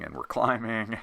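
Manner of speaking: "climbing" repeated with a laugh. Real, not acted. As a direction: chuckle through "climbing".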